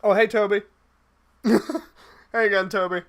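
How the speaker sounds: normal talking voice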